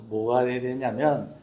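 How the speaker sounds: normal talking voice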